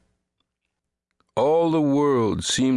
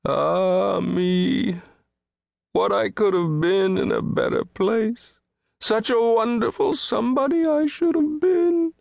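high, self-pitying wail; weeping lament; 14 semitones higher than usual. A narrator's reading. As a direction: bemoan your wretched lot, climbing higher. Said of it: "He wept".

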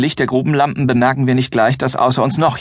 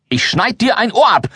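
roaring threat; 11 semitones higher than usual. Acted. Helmerich threatens Hobo pinned on the mattress, roaring. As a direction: bellow it with the knife drawn. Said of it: "brüllt Helmerich".

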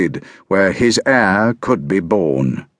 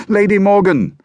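higher and louder call, nearly there; shouting; 11 semitones higher, 4 dB louder than usual. A narrator's reading. Boy at the hovel door, shouting louder for Morgan. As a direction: cry her name at full voice. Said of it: "I shouted as I neared the hovel".

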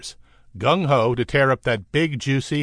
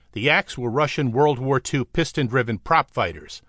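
ordinary delivery, nothing marked

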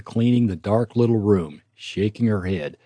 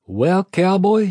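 bright, slow, much higher happy welcome; happy greeting; 9 semitones higher, 5 dB louder, slower than usual. A narrator's reading. A nurse happily welcomes her patient back. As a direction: nurse beams at the waking cowboy. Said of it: "she said happily".

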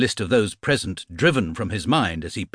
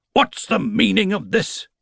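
a raised, furious bark; bellowing; 13 semitones higher than usual. Acted. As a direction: bellow at the unruly class. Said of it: "he bellowed".

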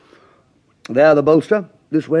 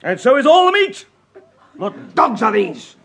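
angry tirade; 8 semitones higher than usual. Acted. Master raging at servants over burnt mutton, shouting. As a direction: throw the dishes about in a fury.

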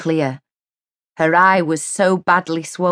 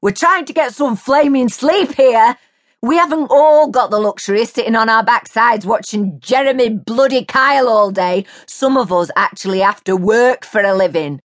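loud, indignant complaint; annoyed neighbour; 7 semitones higher, 5 dB louder than usual. A narrator's reading. Neighbour scolding Mary for the noise. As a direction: tell her off from the doorway.

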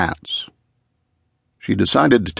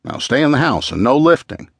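higher-pitched, swooping warning; 7 semitones higher than usual. A narrator's reading. Husband warning his pregnant wife, in a higher-pitched warning voice. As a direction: warn with lively, protective emphasis. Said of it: "he'd warned her".

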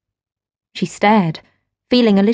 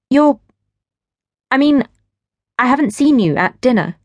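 put-on flustered young wife; 5 semitones higher than usual. A narrator's reading. Gwen answers the stranger, a touch flustered. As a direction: stammer out the answer, caught off guard.

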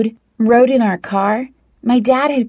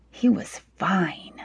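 breathy slow whisper of admiration; 9 dB quieter, slower than usual. Acted. Narrator swooning over Jordan.

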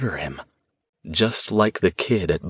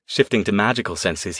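ordinary delivery

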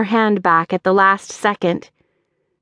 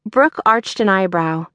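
usual speaking voice

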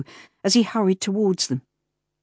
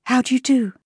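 automatic reply; 8 semitones higher than usual. Acted. Clara echoing the stranger's greeting, automatic and polite. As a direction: answer by reflex, politely.

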